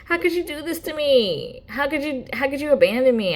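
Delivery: whiny accusing voice. Retold or imitated. Old friends accusing him of abandoning them, high whine. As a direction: plead like a wounded ex asking why you left. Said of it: "looking at me like".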